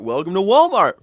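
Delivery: hammed-up greeting to arriving shoppers; old Walmart greeter. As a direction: call it out like an eager store greeter. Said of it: "stand in front of a Walmart".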